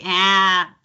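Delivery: "ah" pushed into a twangy short A as in "cat"; Chicago flat A. Shown as a demonstration. Coach showing the Chicago sound, twangy "ah". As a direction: stretch "ah" into a Midwest "aah". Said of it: "it's the ah sound".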